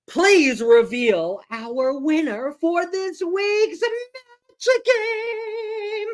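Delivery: slow, drawn-out announcer build rising high; game show host drawing out the reveal. Acted. Announcing the winner dramatically.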